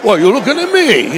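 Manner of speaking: cocky New York mouse picking a fight; brash New York wise-guy bluster; New York accent. Acted. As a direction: challenge them like a New York tough guy. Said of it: "Like a New York mouse".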